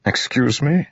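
high startled question; surprised. Acted. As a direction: caught off guard. Royan startled by offer.

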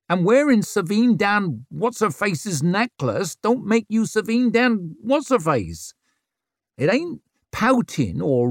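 high, firm lecturing woman; stern scolding. Acted. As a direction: scold her like a mentor. Isern scolding at length.

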